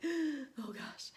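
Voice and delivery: lightly hammed-up worry; mock alarm. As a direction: react like something just popped up. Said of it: "that are like".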